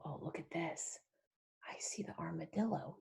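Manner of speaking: quick hushed whisper; fast secret whisper. Acted. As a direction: lean in and whisper the discovery. Conspiratorial aside to child.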